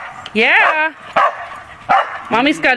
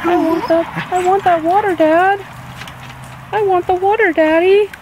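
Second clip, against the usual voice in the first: put-on high, pleading little-kid voice for the dog; whining dog voice; 6 semitones higher than usual. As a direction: beg for the water like a whiny puppy.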